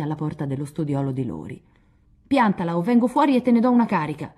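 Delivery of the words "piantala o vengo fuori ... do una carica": loud, higher furious yell through the door; shouted angry threat from behind a door. Acted. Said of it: "minacciò furibondo".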